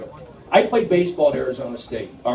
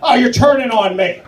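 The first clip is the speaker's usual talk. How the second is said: mock outrage; 8 semitones higher than usual. Mock offense at audience.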